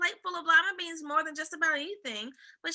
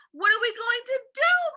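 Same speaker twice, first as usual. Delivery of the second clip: high, loud frantic cry; panicked cry; 10 semitones higher, 9 dB louder than usual. A narrator's reading. Mother panicking over her daughter, high cry. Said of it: "cried Miss Cream".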